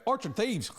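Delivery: high-pitched bad Scouse impression; attempted Scouse accent. Girlfriend earnestly trying Scouse over her pint, high Scouse imitation. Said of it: "my girlfriend tried to do a Scouse".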